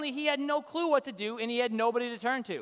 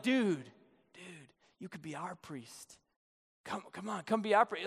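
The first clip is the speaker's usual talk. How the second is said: softer, hushed coaxing; 4 semitones lower, 6 dB quieter than usual. Retold or imitated. Danites luring the priest away, hushed and coaxing. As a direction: lure him in softly.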